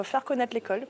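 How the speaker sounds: usual speaking voice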